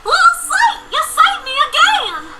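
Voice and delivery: high joyful little filly; happy filly voice. Acted. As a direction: cry out with grateful joy. Filly rejoices at rescue.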